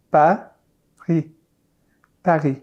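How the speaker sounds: Paris with a soft French r and no friction. Shown as a demonstration. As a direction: say Paris with a gentle throat r. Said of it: "doesn't have a lot of friction".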